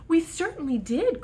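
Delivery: put-on high, loud happy kids; kids crying out. A narrator's reading. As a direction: cheer it back like relieved kids. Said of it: "cried all the kids".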